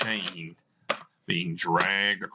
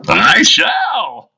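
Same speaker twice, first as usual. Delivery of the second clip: much higher and much louder wailing howl; ghostly wailing cry; 8 semitones higher, 15 dB louder than usual. Acted. Ghost answering Scrooge, loud wailing cry. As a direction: let it out as a crying ghost's shout.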